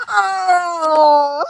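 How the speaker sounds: high crying oh; real wail of heartbreak; pained oh. Real, not acted. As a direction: let the sadness break out. Reacts to sad webtoon line.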